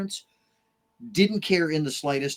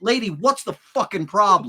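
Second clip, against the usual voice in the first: raised, punchy demand; incredulous dad; 5 semitones higher, 6 dB louder than usual. Retold or imitated. Dad challenging the young teacher, loud and incredulous. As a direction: challenge her on the spot. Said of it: "He turns and looks back at her and goes".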